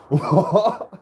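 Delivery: high, loud mock astonishment; gobsmacked. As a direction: blurt it out as replayed shock. Retelling his amazement when she spoke.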